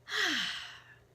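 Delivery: big, loud exhale; real sigh, loud and falling. Real, not acted. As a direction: let out a heavy, audible sigh. Settling in at start of session.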